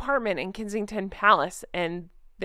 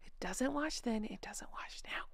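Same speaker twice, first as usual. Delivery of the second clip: whisper; 16 dB quieter than usual. Shown as a demonstration. Vlogger savours the article's phrase in a whisper. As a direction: whisper "it doesn't wash then, it doesn't wash now" unvoiced. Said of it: "So yeah".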